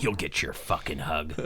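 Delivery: low, soft, slow threat; menacing. Retold or imitated. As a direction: hold back the rage and mutter it. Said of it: "I'm like".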